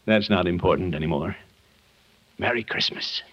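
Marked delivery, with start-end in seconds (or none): 2.4-3.2 whispered Merry Christmas; quiet goodbye. Acted. Visitor sneaking out, whispering goodbye to the children. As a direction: breathe it out softly as he slips away.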